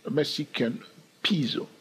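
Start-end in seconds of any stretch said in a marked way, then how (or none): 1.2-1.7 "peezo" with a long ee; peso said as "peezo". Shown as a demonstration. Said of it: "it's not peso".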